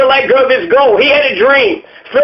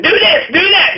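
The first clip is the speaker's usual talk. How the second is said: mocking officer; 7 semitones higher than usual.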